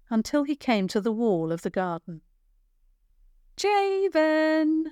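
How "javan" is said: high, carrying call of his name. A narrator's reading. Girl calling out to Javan from the garden. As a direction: call across the garden to him. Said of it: "a voice called".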